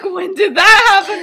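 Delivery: high, loud, laughing disbelief; mock shocked question. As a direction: act out appalled surprise through giggles. Retold shocked question to Lily.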